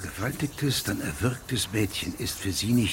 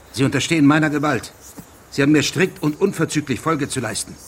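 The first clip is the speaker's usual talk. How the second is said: louder, higher and fast; angry command; 5 semitones higher, 7 dB louder, faster than usual. Acted. Judge angrily orders the gamekeeper to obey. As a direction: lay down the law, furious.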